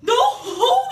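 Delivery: loud, high shout; tea-party hosts shouting at Alice; cried out. Acted. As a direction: yell it in a frantic panic. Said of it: "they cried out".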